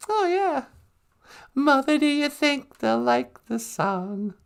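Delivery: lightly camp mock lyric line; sing-songy high playful voice; mock singer. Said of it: "I'm all like".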